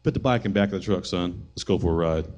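dad grimly taking charge. Retold or imitated. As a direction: keep the anger down low. Angry dad calm and low.

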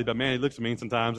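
usual speaking voice

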